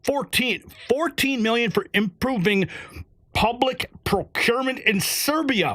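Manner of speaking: ordinary talk, nothing marked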